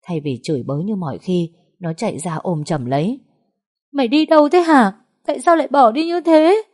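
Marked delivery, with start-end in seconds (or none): 3.9-6.6 put-on very high, loud wailing like a child; crying reproach. A narrator's reading. Sister wailing at her returned sister, crying and scolding. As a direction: sob and scold at once. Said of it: "Nó khóc òa lên như đứa trẻ con, vừa khóc vừa trách móc".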